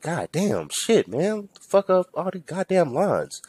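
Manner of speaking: high, swooping exasperated groan; high-pitched grumbling; exasperated griping. Retold or imitated. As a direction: whine about the lines in mock dismay. Griping at the drive-thru line.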